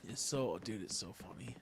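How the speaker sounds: breathless whisper, worn out from laughing. Real, not acted. Laughing with friends over the video, whispered. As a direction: whisper it, still giggling.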